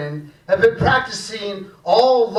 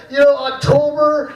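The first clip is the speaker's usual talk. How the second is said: ordinary delivery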